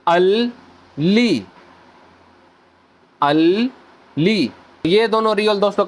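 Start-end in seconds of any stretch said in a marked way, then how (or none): 0.1-4.5 अल and ली split by a clear pause; syllable by syllable. Shown as a demonstration. Teacher sounding out the name for students, split syllables. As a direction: sound out अल then ली separately. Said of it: "तो ये बन जाएगा".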